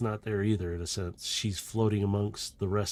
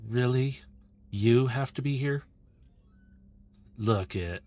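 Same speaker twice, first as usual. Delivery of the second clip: mildly exasperated leader; aggravated; 2 semitones higher than usual. Acted. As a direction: let a touch of aggravation into the words. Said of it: "this aggravation from Carbine's voice".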